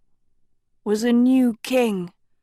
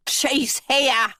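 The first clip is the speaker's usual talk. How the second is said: put-on croaking old man; 3 semitones higher than usual. A narrator's reading. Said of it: "a toothless man croaked".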